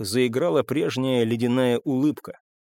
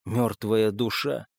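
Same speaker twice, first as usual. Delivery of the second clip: ordinary delivery, nothing marked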